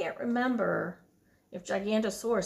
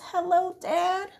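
put-on higher child greeting; young son; 8 semitones higher, 3 dB louder than usual. Son greeting his dad, higher child voice. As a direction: greet your dad brightly. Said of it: "He says".

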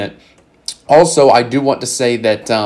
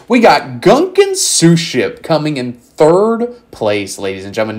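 higher, louder, slow announcer call; hype announcer; 5 semitones higher, 5 dB louder than usual. Acted. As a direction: boom it out like a ring announcer. Announcing third place like announcer.

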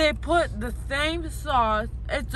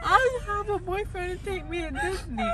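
tearful, sobbing words; crying; 6 semitones higher than usual. Real, not acted. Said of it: "Are you crying?".

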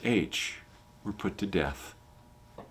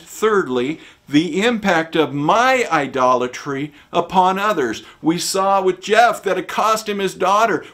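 loud, higher preaching; preaching at full force; 8 semitones higher, 8 dB louder than usual. Acted. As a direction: drive the points home from the pulpit. Preaching the application loudly.

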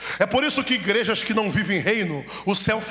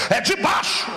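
preaching shout; 7 semitones higher than usual. Real, not acted. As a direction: hit the end like a climax.

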